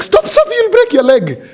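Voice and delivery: very high, loud mock-panicked yell; parent's alarmed shout. Parent warning kids who play at home, shouted in comic alarm. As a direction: cry out like a fussing parent, half laughing.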